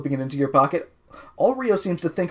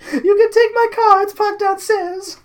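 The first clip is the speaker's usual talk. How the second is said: very high falsetto Countess; hammed-up Countess, pitched way up; 5 dB louder than usual. Countess offering her car, high put-on falsetto. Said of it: "you have to do the Countess".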